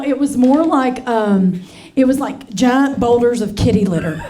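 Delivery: normal talking voice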